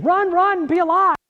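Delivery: high, urgent, alarmed cry; panicked nervous system. Acted. Nervous system voiced as a frantic alarm. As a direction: voice the body's alarm shouting to flee. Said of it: "it kicks in saying".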